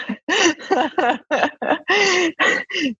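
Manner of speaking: long, high-pitched peal of laughter; laughing. Real, not acted. Guest teased about sharing on the podcast, long laughter. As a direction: keep laughing helplessly for a few seconds.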